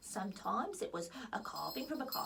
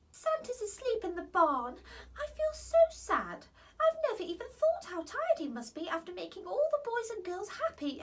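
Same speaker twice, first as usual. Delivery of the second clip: high, sorrowful little fairy; a fairy feeling sorry for Santa; sad fairy; 8 semitones higher than usual. Acted. Holly tells the queen she's sad.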